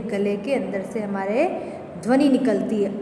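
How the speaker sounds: ordinary delivery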